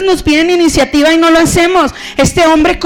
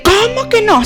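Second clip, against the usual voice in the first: indignant Jezabel; 7 semitones higher than usual. Retold or imitated.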